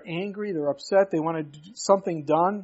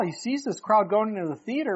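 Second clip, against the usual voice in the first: ordinary talk, nothing marked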